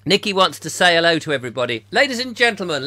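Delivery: ordinary delivery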